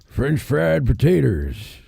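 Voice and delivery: slow, drawn-out character voice; Sling Blade impression. Host answering a jab at his impressions, slow character voice. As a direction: stretch the words in a bad movie impression. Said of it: "It's actually Sling [name]".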